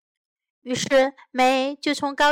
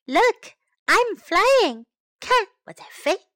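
excited exclamation; 7 semitones higher, 5 dB louder than usual. Shown as a demonstration. Teacher models the sentence for children, excited and loud. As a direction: say it like flying with joy. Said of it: "Now time to learn some English".

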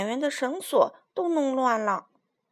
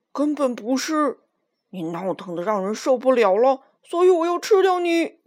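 roaring; 7 dB louder than usual. Acted. Lion roaring at Minus he will eat her, loud roar. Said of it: "吼道".

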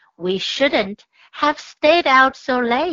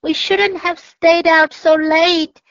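mildly trembling, higher and louder; a small mouse wishing it had gone home; 6 semitones higher, 5 dB louder than usual. A narrator's reading. Mouse out too late in the dark, light tremble. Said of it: "in a trembling voice".

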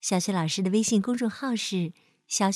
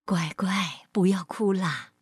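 soothing; 4 semitones lower, slower than usual. Acted. Mother comforts her crying little boy, soothing. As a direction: gently comfort a crying child.

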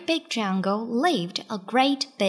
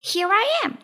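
high little rabbit voice; Clever Rabbit; 10 semitones higher, faster than usual. A narrator's reading. Rabbit shows himself to the lion, high voice. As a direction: pipe up as a plucky small rabbit. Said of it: "said Clever Rabbit".

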